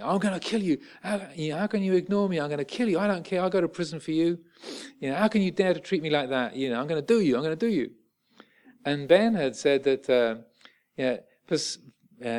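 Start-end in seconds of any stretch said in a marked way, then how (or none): 0.0-7.9 higher-pitched mock threat, imitating an angry man; enraged bloke's threat, retold. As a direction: voice a furious stranger without real force. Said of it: "got really enraged and came charging over".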